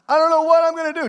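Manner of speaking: high, loud panicked whine; whiny despairing voice. Acted. Churchgoer whining to the pastor, high and panicked. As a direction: play the lost believer.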